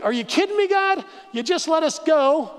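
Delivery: high-pitched protest to God; mock outraged complaint; indignant complaint. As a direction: plead in disbelief, voice climbing high. Acting out the thirsty Israelites.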